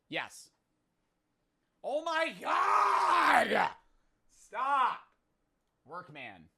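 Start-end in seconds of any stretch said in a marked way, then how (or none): 1.8-5.0 high, drawn-out shriek; stretched high wail of disbelief; shocked outburst. Real, not acted. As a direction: cry out in disbelief. Shocked outburst during guessing game.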